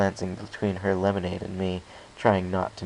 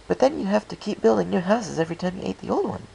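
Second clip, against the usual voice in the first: rambling Pinkie; 11 semitones higher, faster than usual. Acted. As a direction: chatter on about a silly pun.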